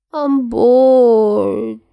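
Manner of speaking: high, loud whine; whiny. Acted. Peter whining to Tony he's bored. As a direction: drag the word out like a bored kid. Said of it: "He whines again".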